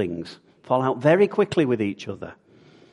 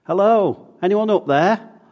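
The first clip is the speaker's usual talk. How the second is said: high, loud call for help; shouted call; 9 semitones higher, 10 dB louder than usual. Acted. Man hanging from a cliff branch, shouting for help. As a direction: call up loudly to whoever is above. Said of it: "he shouted".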